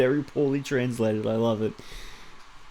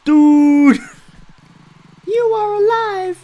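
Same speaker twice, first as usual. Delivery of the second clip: high, very loud hammed-up game hero; overacting the clumsy dialogue; squealing high mock hero calls; mock character voice; 13 dB louder, much slower than usual. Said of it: "dude speaks like that".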